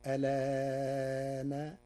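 ألان with the vowel held at medium length, very slow; medium elongation. Shown as a demonstration. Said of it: "التوسط فيقرأها هكذا".

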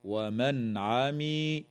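نون of ومن pronounced fully before عمي; clear noon. Shown as a demonstration. Teacher showing izhar to students, very slow chant. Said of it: "تظهر النون الساكنة عند العين".

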